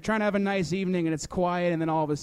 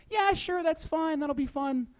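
mimicking a parent in a much higher voice; high mock parent; 10 semitones higher, 3 dB quieter than usual. Comic mocking his parents' cheerful approval, pitched high. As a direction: chirp it like an overly supportive parent. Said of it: "And they're like".

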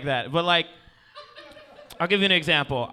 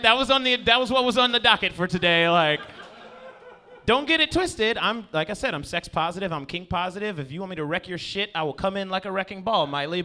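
normal talking voice

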